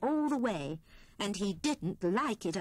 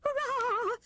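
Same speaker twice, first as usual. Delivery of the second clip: very much higher cheer; Squirrel's squeaky hurrah. A narrator's reading. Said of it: "cried Squirrel".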